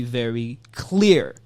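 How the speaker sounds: ordinary talk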